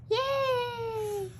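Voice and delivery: high, thin cheer of tiny creatures; excited cheer. Acted. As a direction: squeal it with joy.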